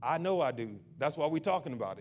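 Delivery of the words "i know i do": ordinary talk, nothing marked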